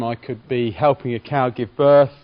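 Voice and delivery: ordinary talk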